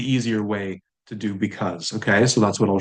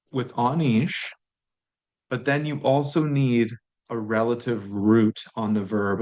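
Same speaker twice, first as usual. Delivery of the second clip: ordinary talk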